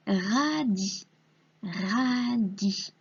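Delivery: "radis" in two long syllables, ra-di; sounded-out word. Shown as a demonstration. Teacher dictating a word to a pupil, slow syllables. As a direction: draw out "radis" so each sound is heard. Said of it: "bien entendre les sons qui composent le mot".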